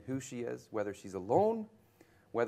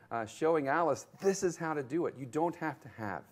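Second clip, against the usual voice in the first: ordinary talk, nothing marked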